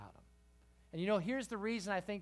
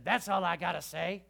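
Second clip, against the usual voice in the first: a hint of preacher's voice, louder; lightly imitated pastor; 6 dB louder than usual. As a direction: lightly mimic a preacher wrapping up. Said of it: "like that".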